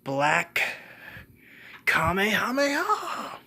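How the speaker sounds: high, loud anime battle cry; attack yell. Acted. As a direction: yell the attack name, stretched and soaring. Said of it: "Then he yells".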